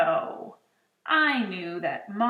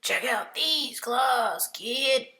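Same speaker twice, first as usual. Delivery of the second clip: slow, rasping monster voice; 4 semitones higher, 3 dB louder, slower than usual. Acted. Third monster showing off claws, rasping. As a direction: rasp it out slowly.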